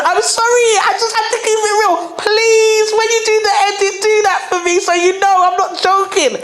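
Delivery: very high mock-desperate plea; high-pitched pleading. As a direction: beg the editor in a high, playful voice.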